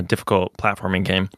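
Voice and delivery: ordinary delivery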